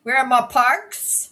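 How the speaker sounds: loud, high, exaggerated Kiwi accent; drawn-out Kiwi vowels, hammed up. Shown as a demonstration. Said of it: "This is how I hear Kiwis".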